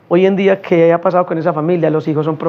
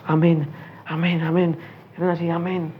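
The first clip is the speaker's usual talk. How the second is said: much softer, meek little amens; 7 dB quieter than usual. Retold or imitated. The gang murmurs amen to the praying preacher, very soft. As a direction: whisper the amens like scolded boys.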